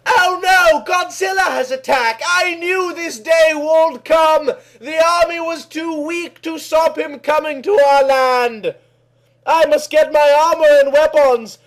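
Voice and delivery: high, loud alarmed cry; alarmed heroine. Acted. Kelly sees Godzilla wreck the town, crying out in alarm. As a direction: call to arms in a panic.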